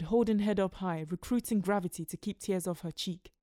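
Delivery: ordinary delivery, nothing marked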